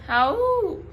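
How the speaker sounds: high, loud howls that fade; distant wolves howl back; answering wolf howls. A narrator's reading. Wolves answering the dog, high howls fading. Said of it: "And then again".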